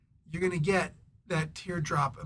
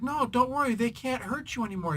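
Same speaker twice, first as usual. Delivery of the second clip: lightly acted reassurance, pitched up; higher mock-soothing voice; reassuring hero; 6 semitones higher than usual. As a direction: quote a hero calming a scared kid.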